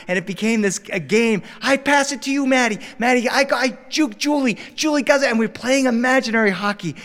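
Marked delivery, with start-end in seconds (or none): 1.6-5.3 put-on high, eager kid calling the play; excited kid play-by-play. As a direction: narrate the pretend game like a thrilled child. Kids playing imaginary hockey.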